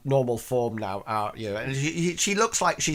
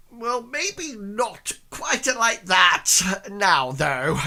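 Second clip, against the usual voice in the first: put-on lightly raised, slow old man voice; 9 semitones higher, 4 dB louder, slower than usual. Old man Stanley backing off from his wife's lycra claim. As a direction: hedge awkwardly.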